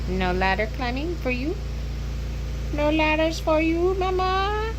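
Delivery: high, sing-song pet talk. Real, not acted. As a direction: coo at the dog in a high voice. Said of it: "talking to the dog".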